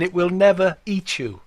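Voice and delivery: normal talking voice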